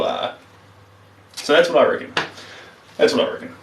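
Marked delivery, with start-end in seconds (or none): none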